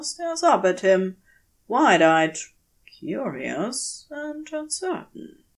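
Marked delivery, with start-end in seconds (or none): none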